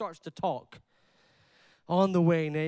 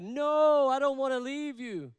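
higher, pleading daughter-in-law; a touch of a tearful plea; pleading Orpah; 6 semitones higher than usual. Acted. Preacher voicing Orpah begging Naomi, higher and pleading.

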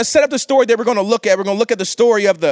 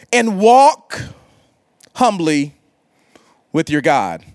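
scripture reading; 6 semitones lower, slower than usual. Acted.

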